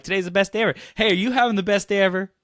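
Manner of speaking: high, loud and fast; overexcited greeting. Retold or imitated. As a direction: bounce up to a stranger beaming. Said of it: "'cause I'm like".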